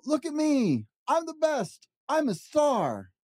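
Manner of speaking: high show-off yelling pug; Pig shouting. Acted. Pig bragging to everyone, high showy shout. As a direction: brag it high and proud, a pug in the spotlight. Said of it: "He'd shout".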